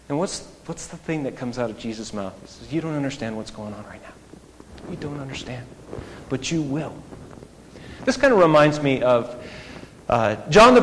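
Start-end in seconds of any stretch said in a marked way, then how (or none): 2.7-6.9 gentle reply. Retold or imitated. Jesus gently answering Peter.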